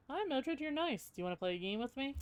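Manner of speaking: high, sweet doll voice; creepy doll voice. Acted. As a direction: coo like a sinister little doll. Doll greets player in high voice.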